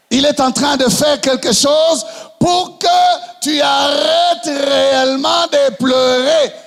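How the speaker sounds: full-force sermon delivery; fiery preaching. Acted. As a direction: rouse the congregation. Preaching climax.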